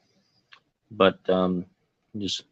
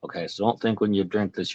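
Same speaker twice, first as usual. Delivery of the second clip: usual speaking voice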